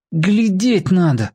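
a mild reproach through the teeth; through clenched teeth. A narrator's reading. Boy to Серёга after taking his snowball, through clenched teeth. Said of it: "сказал сквозь зубы".

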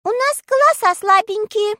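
put-on high squeaky small-girl voice; shy girl squeaking an excuse. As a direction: squeak it out timidly. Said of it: "пискнула Света Карамелькина".

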